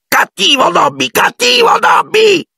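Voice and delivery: extremely high, slow self-scolding shriek; shouting. A narrator's reading. House-elf banging his head on the window, shrieking. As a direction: scream it while punishing yourself.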